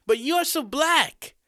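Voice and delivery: very high, loud, whiny schoolgirl; incredulous student. A narrator's reading. Student objects to the teacher's explanation, high-pitched and incredulous.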